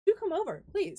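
flirty invitation. Acted. DM voices flirtatious Genasi.

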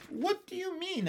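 high-pitched, lightly amused question. A narrator's reading. Lady asks the children about their dog, amused. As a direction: ask it high, with a touch of amusement.

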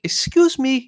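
high, loud put-on foreign accent; squeaky-high mock foreign girl; cutesy high-pitched voice. Man imitating a foreigner catching someone's attention, cutesy high accented voice. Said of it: "cutesy, high-pitched voice".

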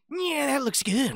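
high, hammed-up creep voice; mock over-eager stranger; imitated creepy stranger. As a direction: squeal it with exaggerated relish. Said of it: "just somebody's just like".

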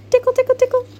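bouncy high sing-song tickle; playful tickle. A narrator's reading.

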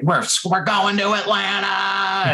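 high excited cry, half-held back; excited yell. Retold or imitated. Telling his bandmates the news amid the crowd, excited high yell. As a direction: blurt out the big news, thrilled. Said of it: "screamed it at him".

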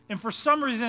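ordinary talk

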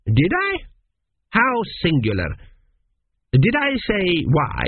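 a lightly voiced vague father, groping for words; vague king. A narrator's reading. Vague Pharaoh slowly quizzing his son, hesitant.

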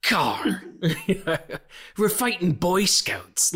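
mock pirate brogue, breathy and laughing, slow; Dodgeball pirate. Quoting Alan Tudyk's Dodgeball pirate, mock brogue. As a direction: rasp it out like a giggling pirate. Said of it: "what Alan Tudyk is doing in, in Dodgeball".

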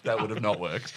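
ordinary talk, nothing marked

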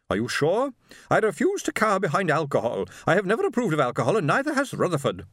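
high-pitched, prim indignation; haughty, disapproving wife. A narrator's reading. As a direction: declare your disapproval like a duchess. Haughty wife declares disapproval of alcohol.

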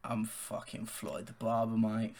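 low, soft Cockney hard man; London accent. Shown as a demonstration. Co-host showing off his best Cockney, low London accent. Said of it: "Give us your best London accent".

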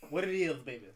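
hammed-up southern accent. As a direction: belt out a playful southern greeting. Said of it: "in your southern accent".

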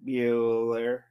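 low monotone teacher impression; Ben Stein. Host teasing co-host about sounding insincere, Ben Stein monotone. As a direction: drone it out, long and dry. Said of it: "say it like Ben Stein".

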